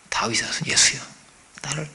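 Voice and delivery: softly, meekly call for mercy; half-hearted murmured cry for help; timid quiet plea. Shown as a demonstration. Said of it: "이렇게 하지 않았겠죠".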